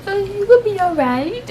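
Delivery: high sing-song retold comfort; unconvincing reassurance. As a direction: brush off the worry in a high put-on voice.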